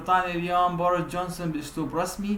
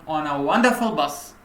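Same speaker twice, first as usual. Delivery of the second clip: pitched up and louder; raised voice; 5 dB louder, much slower than usual. Shown as a demonstration. Teacher demonstrating the prime minister's intonation to students, raised voice. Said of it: "بطريقة عالية".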